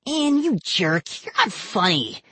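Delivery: put-on high, angry kid growl. A narrator's reading. Boy angry at cousin over the dummy's insult, growling. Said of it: "Vinny growled".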